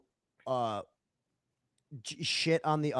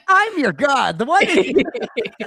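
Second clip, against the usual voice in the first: laughing, slow, high playful deity voice; mock god voice; 9 semitones higher, 10 dB louder, slower than usual. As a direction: lord it over them with a laugh.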